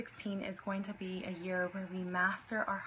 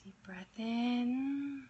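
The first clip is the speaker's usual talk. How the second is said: guided meditation; 6 dB quieter than usual. Acted. Leads a meditation.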